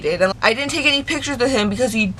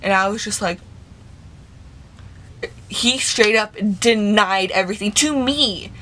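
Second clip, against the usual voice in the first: ordinary delivery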